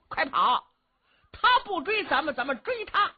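high, loud, laughing cry; gleeful shout. Acted. Qin Qiong laughing to his brother as they chase. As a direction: crow over turning the chase.

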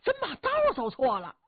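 high, fretful inner voice of a scrawny official; panicking to himself that he took the wrong road; worried thin-voiced official. A narrator's reading. Scrawny official panicking to himself, high and fretful. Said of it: "赵世显心说".

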